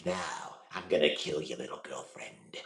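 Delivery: low, soft villain threat, drawn out. Shown as a demonstration. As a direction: menace slowly in a deep rasp. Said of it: "lowered it, lowered it, rasped it".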